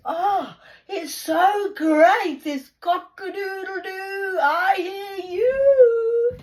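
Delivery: loud, high, drawn-out mock crowing; hammed-up cock-a-doodle-doo, held long; rooster crow. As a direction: crow it out like a cockerel.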